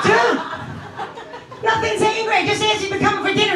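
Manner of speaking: high, defensive pleading; exasperated mom protest. Retold or imitated. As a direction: protest your innocence in a high voice. Mother protests to son on phone.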